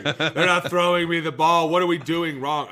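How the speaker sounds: loud, high, laughing through the complaint; laughing mock panic. A player in his new team, loud laughing panic. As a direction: play a player who regrets his trade. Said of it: "you're like".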